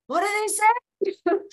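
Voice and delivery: muffled co-star in a helmet. Retold or imitated. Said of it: "he kept going to me".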